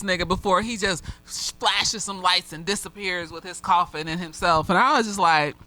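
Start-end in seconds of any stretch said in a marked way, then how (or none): none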